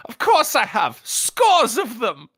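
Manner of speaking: emphatic, drawn-out mock boast; quoting Archer. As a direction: act out an indignant cartoon comeback, stretching the end. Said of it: "makes me think of that episode".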